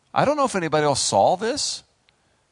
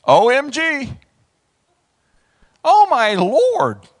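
loud, high mock horror; stretched, high-pitched outcry; shocked exclamation; 9 semitones higher, 9 dB louder, slower than usual. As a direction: wail it in exaggerated disbelief. Acting Gideon's shock.

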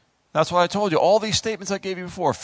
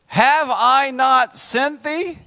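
slow, loud, high scriptural demand; stretched-out, imposing question; God's challenge; 9 semitones higher, 6 dB louder, much slower than usual. Acted. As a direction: thunder it as the Lord questioning a servant.

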